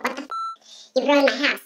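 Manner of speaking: very high squeaky disbelief; comic high-pitched dismay; mock outrage. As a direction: squeak it out like an appalled homeowner. Homeowner's retold outburst.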